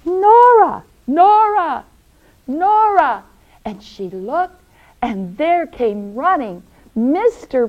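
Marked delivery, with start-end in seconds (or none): 0.0-3.2 loud, high calling out; raised, excited shout for someone; yelling her name. Acted. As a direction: call urgently across a distance. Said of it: "she heard someone yelling".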